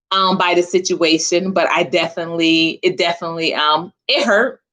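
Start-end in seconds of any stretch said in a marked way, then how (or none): none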